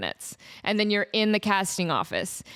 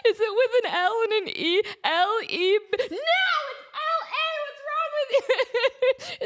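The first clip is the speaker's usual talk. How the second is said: high, laughing mom voice, scolding; hammed-up exasperated mom with a laugh; mock scolding mom. Mom scolding her daughter over spelling, high laughing mock scolding.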